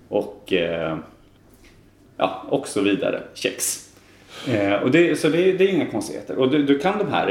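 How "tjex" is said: "Kex" starting on tje, not K; soft tje-sound. Shown as a demonstration. Guest closing list of examples, tje in "Kex". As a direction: say "Kex" like "tjex". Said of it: "så uttalar man ju ett K-ljud".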